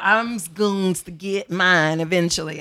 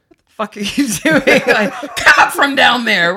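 louder, laughing mock shout; mock harsh yell; 5 semitones higher, 10 dB louder than usual. Host mimicking a rude woman, laughing loud. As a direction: bark it out as a joke, laughing. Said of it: "We're never gonna be like".

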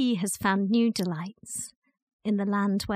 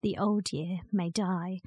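ordinary delivery

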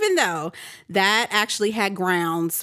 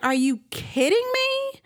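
exasperated disbelief. Retold or imitated. Woken by neighbors' loud party.

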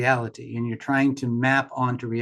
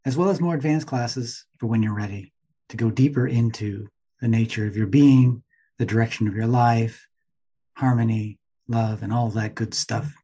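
ad read; 3 semitones lower than usual. Acted. Reads a plug for his meditation.

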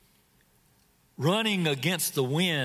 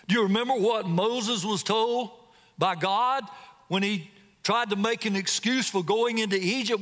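ordinary talk

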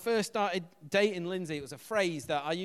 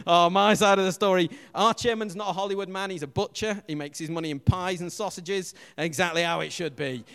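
proud cheer; 10 dB louder than usual. Real, not acted. Proud of butcher chairman.

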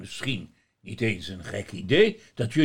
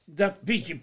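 laughing; 9 semitones higher, 6 dB louder than usual. Acted. Sinterklaas laughing, asking for water.

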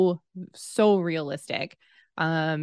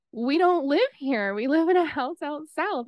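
higher-pitched, insistent correction; mock-exasperated repeat, pitched up; insistent retelling; 8 semitones higher than usual. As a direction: insist on it, voice lifted, retelling an argument.

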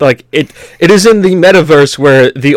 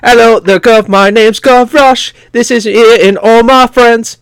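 high-pitched, slow mock Cockney kid; Cockney accent; 8 semitones higher than usual. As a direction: do Gavroche as a cheeky Cockney urchin. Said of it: "Cockney accent at the beginning of the second act".

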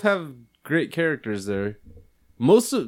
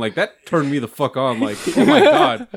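ordinary talk, nothing marked

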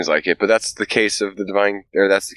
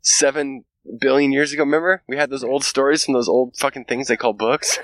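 put-on higher, amused old-timer alien; mock alien reminiscing; 7 semitones higher than usual. Alien remembering humans and their books, higher mock voice. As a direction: recall our dumb past fondly. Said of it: "they're like".

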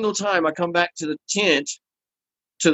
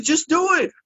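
high-pitched, insistent retort; impatient order, pitched way up; 12 semitones higher than usual. Retold or imitated. Patient orders the nervous piercer on, insistent.

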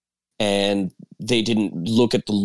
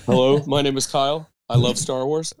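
lightly acted support-group introduction; mock support-group confession; 4 semitones higher than usual. Joking that the show is Star Wars therapy, mock confession. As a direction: introduce yourself like a member at a meeting, playfully. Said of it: "this is A A for Star Wars".

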